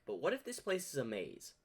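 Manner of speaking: ordinary talk, nothing marked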